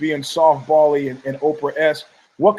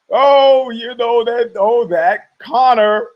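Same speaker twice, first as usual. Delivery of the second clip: higher, louder, slow fond "oh, that Conor"; sitcom audience; 6 semitones higher, 4 dB louder, much slower than usual. Retold or imitated. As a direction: coo it like a laughing studio crowd.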